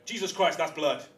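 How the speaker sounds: high, loud startled exclamation; imitating a startled doctor; mock alarm. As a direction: blurt it out in shock. Doctor's alarmed reaction retold.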